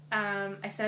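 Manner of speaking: ordinary talk, nothing marked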